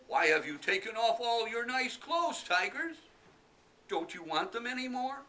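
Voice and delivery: high, loud teasing call; boy calling out. Acted. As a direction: call out cheekily to the tigers. Boy calls to tigers.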